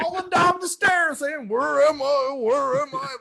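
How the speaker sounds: high, loud bewildered babble; lost old man, hammed up; mock confused old man.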